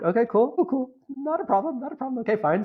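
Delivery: chirpy fake calm, telling himself it's cool; forced calm. To himself while feeling strangled, forced chirpy calm.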